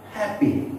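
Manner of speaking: "happy" with a high HAP, light ending; stress on first syllable. Shown as a demonstration. Teacher listing adjectives for learners, first-syllable stress. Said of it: "here also we stress on first syllable".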